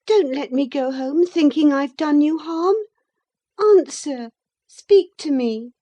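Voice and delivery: put-on high, loud distressed girl; urgent pleading. Catherine begs Linton to say he is unharmed, high urgent plea.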